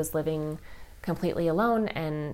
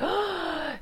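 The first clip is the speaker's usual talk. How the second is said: imitated gasp; 12 semitones higher than usual. Daughter sees the license plate.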